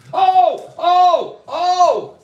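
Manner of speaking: high, loud startled yelps; sudden pained oh. Real, not acted. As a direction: yelp as if you just stepped on a pin. Steps on a pin.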